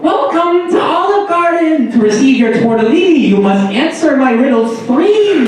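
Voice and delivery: high-pitched riddling troll; Olive Garden troll. Pretend. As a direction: screech it like a fairy-tale troll. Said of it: "That's the Olive Garden troll".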